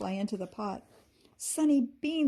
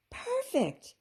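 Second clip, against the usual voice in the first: delighted. A narrator's reading. Amber receives the needle.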